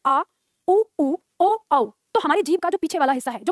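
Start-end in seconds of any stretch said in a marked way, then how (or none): 0.1-1.9 "आ, उ, उ, ओ, औ" sounded out singly and firmly; vowel demonstration. Shown as a demonstration. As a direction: pronounce "आ, उ, उ, ओ, औ" one vowel at a time. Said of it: "जब हम उच्चारण करते हैं". ("आ, उ, उ, ओ, औ" का अलग-अलग, साफ़ उच्चारण)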